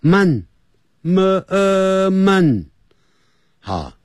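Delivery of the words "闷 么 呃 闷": 闷 said higher as the sound to avoid; teacher mimicking a bad Sunday vowel; mock wrong sound. Shown as a demonstration. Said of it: "肚子饿的饿".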